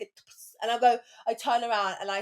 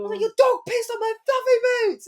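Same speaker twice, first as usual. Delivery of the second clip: high-pitched outraged cry; shrieked complaint, re-enacted at moderate volume; retold scream; 11 semitones higher, 4 dB louder than usual. Daughter screaming at mum about her boots, outraged. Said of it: "I screamed at my mum".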